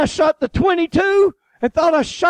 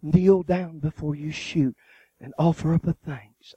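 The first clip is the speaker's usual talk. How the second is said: solemn voice of Jesus; 10 semitones lower, 7 dB quieter than usual. Retold or imitated. Jesus imagined instructing the preacher, low and hushed. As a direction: drop down deep and hush it like a holy command. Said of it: "I never heard him tell me".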